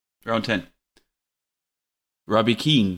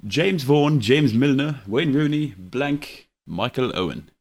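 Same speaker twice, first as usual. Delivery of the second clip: put-on English accent for a quiz list; best English accent; 3 semitones higher, 3 dB louder, slower than usual. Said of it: "my best English accent for you".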